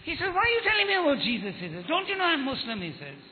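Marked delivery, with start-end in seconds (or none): 0.3-1.5 high, quick indignant challenge; mock-indignant question, pitched well up; angry retort. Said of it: "got very angry with me". 1.9-2.8 high-pitched indignant protest; retold offence, voice pitched well up; angry protest. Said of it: "got very angry with me".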